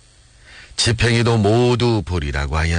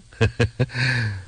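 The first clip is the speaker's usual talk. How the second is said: soft acted laugh in short bursts; gleeful chuckle; 5 dB quieter than usual. A narrator's reading. Soldier pleased at talk of their strength, gleeful chuckle. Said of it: "싱글벙글하며 대꾸했다".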